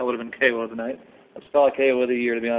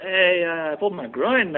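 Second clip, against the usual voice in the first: higher-pitched put-on French-Canadian accent; bad French-Canadian accent impression; terrible G S P; 5 semitones higher than usual. Fighter telling his corner he pulled his groin, bad French-Canadian accent. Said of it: "my terrible G S P impression".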